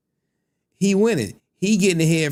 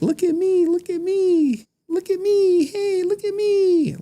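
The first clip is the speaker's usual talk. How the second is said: very high, whiny look-at-me voice; attention seeker. Retold or imitated. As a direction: beg for attention in a squeaky pitch. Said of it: "Then you got ninjas like".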